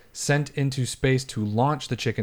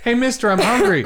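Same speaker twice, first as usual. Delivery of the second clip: put-on high, loud whiny kid begging; hungry kid; 11 semitones higher, 6 dB louder than usual. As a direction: beg like a hungry little kid. Repeat of the kid skit.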